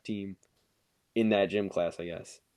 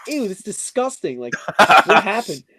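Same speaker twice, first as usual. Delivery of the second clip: higher-pitched, irritated disgust; angry disgust; 7 semitones higher, 7 dB louder than usual. Retold or imitated. Student reacting to the mess, angry. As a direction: retell it pissed off and grossed out. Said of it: "Like, I was angry".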